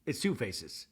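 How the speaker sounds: low, soft, calm imitation; imitated calm pronouncement, kept low. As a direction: state it calmly, low and quiet. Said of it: "Mary just calmly says".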